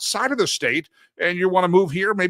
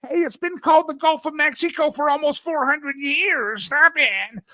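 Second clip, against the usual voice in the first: higher, huffy put-on voice; mock indignant voice; 9 semitones higher than usual. Host reading a meme as a huffy scold.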